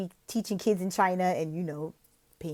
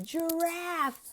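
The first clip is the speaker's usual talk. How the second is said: tag; 9 semitones higher, 6 dB quieter than usual. Shown as a demonstration. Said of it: "maybe they could tell you".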